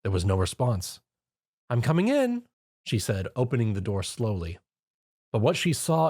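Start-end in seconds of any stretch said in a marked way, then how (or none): 1.7-2.4 motherly call. Acted. Mrs Cake.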